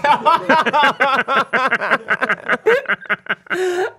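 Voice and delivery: long, high peal of laughter; helpless laughter. Real, not acted. Podcaster cracking up over a text reply, helpless laughter. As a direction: crack up loudly and keep going.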